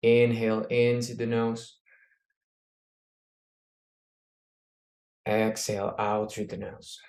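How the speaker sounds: calm breathing coach cues; guided breathing. Acted. Leads a breathing exercise.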